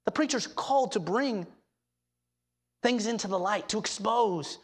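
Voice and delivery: usual speaking voice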